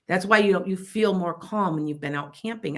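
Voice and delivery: ordinary delivery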